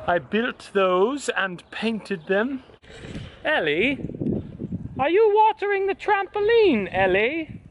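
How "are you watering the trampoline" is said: calling out. Real, not acted. Calling to child outside.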